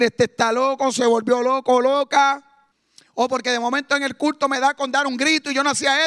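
loud, driving sermon voice; full-force preaching. Acted. Preacher exhorting the church, loud and driving.

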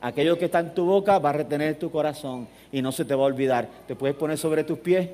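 ordinary talk, nothing marked

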